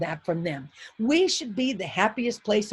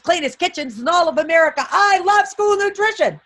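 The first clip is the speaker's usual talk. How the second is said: high, loud, fired-up cheer building to a yell; losing her mind; 7 semitones higher, 7 dB louder than usual. Shown as a demonstration. Speaker rallying cafeteria workers, losing her mind with loud excitement. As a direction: whip it up into an ecstatic shout. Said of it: "so I lose my mind".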